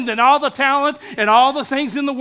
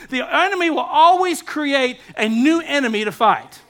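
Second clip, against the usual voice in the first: preaching; 4 semitones higher than usual. Acted. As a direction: drive it home to the congregation. Preaching builds to shouts.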